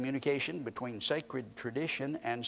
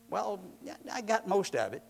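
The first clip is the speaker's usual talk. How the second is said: mock excuse; 6 semitones higher than usual. Imitating half-obedient listener.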